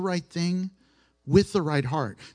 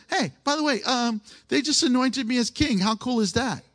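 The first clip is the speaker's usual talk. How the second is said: higher, bright boastful king; proud David; 9 semitones higher than usual. Retold or imitated. Preacher voicing a proud David to the church, higher and delighted. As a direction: play David thrilled with his new crown, pitched up.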